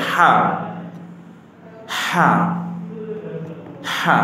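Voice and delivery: হা recited singly with pauses between repeats; careful letter pronunciation. Shown as a demonstration. Teacher drills a red-marked letter, careful pronunciation. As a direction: model হা carefully, three separate times. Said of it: "শুদ্ধ সঠিক উচ্চারণে".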